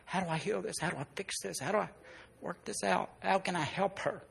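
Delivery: hushed, pleading private prayer; very soft retold prayer; praying. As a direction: murmur a helpless question to God. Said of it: "praying before the Lord".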